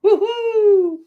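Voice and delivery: a very high, loud cheer; excited whoop. Real, not acted. Celebrating his correct answer with chat, high excited cheer. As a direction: shout it in triumph.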